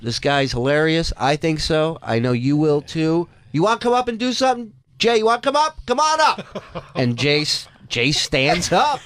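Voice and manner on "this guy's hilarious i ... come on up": high, fast showman voice; rising high as he invites him up; Robin as host. Retold or imitated. Comedian hypes up a man in the crowd, high and fast. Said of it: "and he goes".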